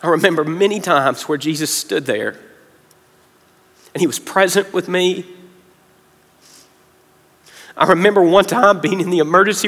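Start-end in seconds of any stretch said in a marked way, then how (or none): 0.0-5.2 soft, halting delivery with long pauses; getting emotional. Real, not acted. Preacher recalling hard times to the congregation, getting emotional. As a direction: remember it quietly, holding emotion back. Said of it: "about to get emotional now".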